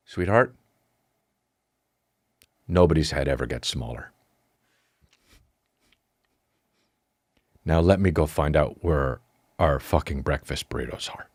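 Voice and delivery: low, measured, deliberately controlled; as calm as you can manage, pitched low; forced calm. Retold or imitated. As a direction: hold it down with long pauses between phrases. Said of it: "as calmly as I said, as I could".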